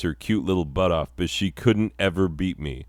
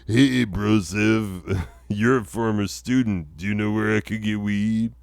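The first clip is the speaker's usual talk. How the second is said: hey-bro weed seeker; 3 semitones higher than usual. Acted. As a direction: stretch out "Hey" like a stoner asking for a favour. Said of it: "This font and background just screams".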